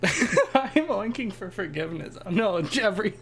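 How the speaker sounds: high squealing laugh through words. Real, not acted. Laughing, playful pig protest.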